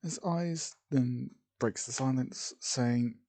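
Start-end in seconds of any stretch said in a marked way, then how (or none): none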